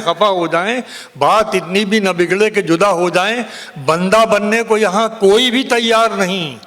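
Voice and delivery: ringing, declaimed Urdu ghazal; poetry recitation. Acted. Poet reciting a ghazal to a cheering audience, declaimed. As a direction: declaim the verse in sweeping phrases, pausing for effect.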